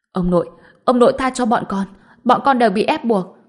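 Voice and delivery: loud, fast pleading for mercy; desperate begging. Acted. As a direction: cry out begging on your knees. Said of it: "quỳ xuống kêu lên".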